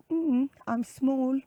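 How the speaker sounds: high, slow little bee voice; pleased-with-herself tiny bee; proud bumblebee. Acted. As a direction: savour it proudly in a high, small voice. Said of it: "so proud of herself".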